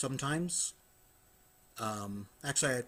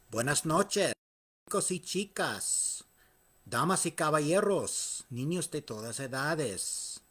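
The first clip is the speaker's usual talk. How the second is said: lucha libre announcer; 3 semitones higher than usual. Acted. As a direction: call it out to the arena in Spanish. Spanish repeat of announcer greeting.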